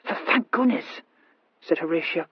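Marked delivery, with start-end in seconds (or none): 0.0-1.0 exasperated. Acted. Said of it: "quite exasperated".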